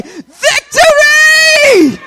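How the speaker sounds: high, drawn-out victory shout; basketball crowd cheer. Shown as a demonstration. Preacher showing the congregation how fans yell, roaring cheer. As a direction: holler it like a fan at the game. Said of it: "So I need to hear y'all say".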